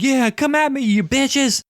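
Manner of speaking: very high, strained challenge; hammed-up action hero daring them on; mock battle taunt. Acting out Rox taunting burning zombies.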